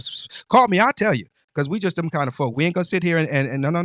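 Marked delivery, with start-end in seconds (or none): 0.0-0.5 whispered psst psst like someone spreading rumors; mock gossip whisper. Mocking gossipers who whisper behind his back instead of calling. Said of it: "Don't go around saying". 0.5-1.3 call me out. Real, not acted. Challenging gossipers directly.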